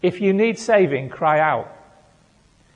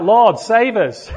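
slow, raised half-joking plea; mock cry for rescue; 5 semitones higher, 6 dB louder, slower than usual. Pastor joking to the church, a mock desperate plea. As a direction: ham up a desperate prayer over a bad joke. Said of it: "I, I cried out".

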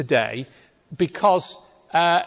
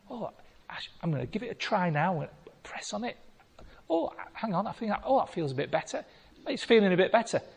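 tentative murmur; 7 dB quieter, faster than usual. Pretend. Voicing someone testing their hip.

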